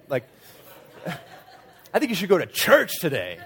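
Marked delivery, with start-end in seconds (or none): 1.9-3.4 "church" punched high and loud; stress on CHURCH. Shown as a demonstration. Preacher playing the emphasis game, comic stress on "church". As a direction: rush up to a big, loud "church". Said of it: "change the emphasis of the words".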